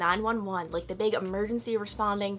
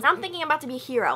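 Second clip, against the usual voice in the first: ordinary delivery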